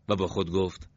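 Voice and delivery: usual speaking voice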